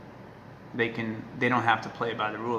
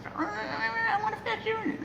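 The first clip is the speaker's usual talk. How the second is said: very high, slow, whiny pleading; squeaky pup asking for cuddles; dog's voice; 4 dB quieter, much slower than usual. Pretend. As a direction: beg like a spoiled puppy. Voicing the dog's demand.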